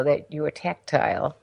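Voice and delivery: ordinary talk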